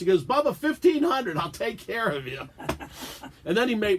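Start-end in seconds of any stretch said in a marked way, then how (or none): 0.2-2.5 mock salesman. Imitating the salesman's deal.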